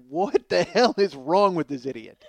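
loud, slow, laughing disbelief; incredulous yell. Retold or imitated. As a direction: shout it in disbelief. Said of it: "everybody else is just like".

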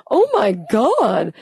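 slow, drawn-out mock horror; mock gasp. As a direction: stretch it out in fake shock. Mocking overreaction.

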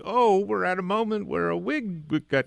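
mock audience realization, pitched high; imitated audience reaction. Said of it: "everyone begins to go like".

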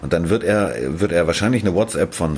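ordinary talk

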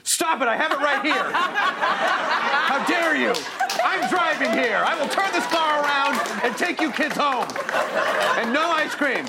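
high mock parent scolding; refereeing kids in car. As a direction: playfully scold kids from driver's seat. Host plays parent scolding kids.

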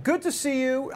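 high-pitched, held-up mock greeting; put-on high greeting. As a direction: greet him in an exaggerated high voice. Exaggerated greeting, then redone normally.

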